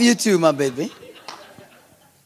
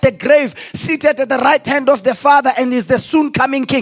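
full-force preaching; 3 semitones higher than usual. Acted. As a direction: shout the promise like a pastor at full force.

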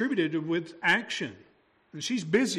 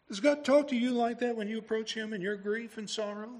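ordinary delivery

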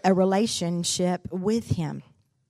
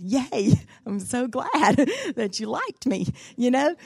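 put-on childish glee; 5 semitones higher, 5 dB louder than usual. Mock childish delight.

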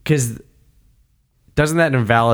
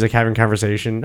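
normal talking voice

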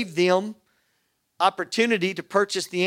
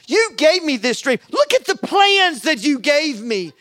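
higher-pitched pleading protest, retold; worked-up, insistent complaint in prayer; frustrated with God; 4 semitones higher than usual. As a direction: argue your case to God in exasperation. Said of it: "just frustrated with God".

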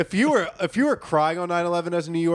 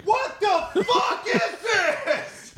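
very high, long-stretched yell; fire yeller's scream; much slower than usual. Acted. Fire yeller arriving at a disaster, screaming. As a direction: drag every word out in a comic shriek. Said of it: "the fire yeller shows up and he goes".

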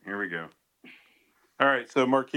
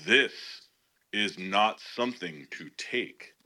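imagined prophetess voice. Acted. Host reading her written prophecy aloud, mock prophetess proclamation. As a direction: intone it like a solemn warning from God, stretching key words. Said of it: "I'm gonna read it like this".

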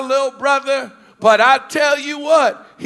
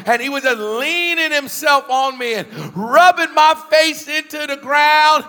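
ordinary delivery

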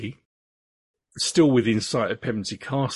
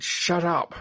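lightly acted shout, raised in pitch; a higher, restrained shout at no great volume; mock shout; 7 semitones higher than usual. Attacker silencing his screaming victim, mildly shouted. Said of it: "While Gray shouted".

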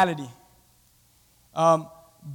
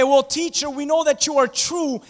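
sly Pharisee; 8 semitones higher, 4 dB louder than usual. Retold or imitated. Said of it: "they came up to Jesus and they say".

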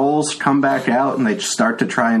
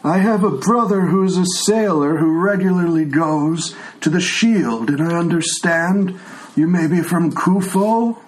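put-on a frail old man who can't hear; old blind tiefling; 5 semitones higher than usual. Old tiefling NPC dialogue.

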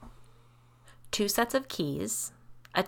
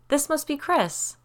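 high, slow, louder social pleasantry; breezy greeting; 9 semitones higher, 5 dB louder than usual. A narrator's reading. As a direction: stretch it out like a casual introduction. Kate's breezy greeting continues.

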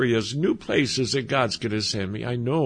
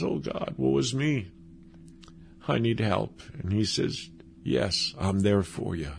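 much softer, humble plea; hushed plea; 4 semitones lower, 6 dB quieter than usual. Acted. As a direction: bring it down to a confession. We cry to God, He answers.

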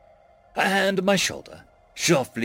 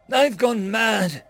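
sobbing; 8 semitones higher, 4 dB louder, much slower than usual. A narrator's reading. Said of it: "I sobbed".

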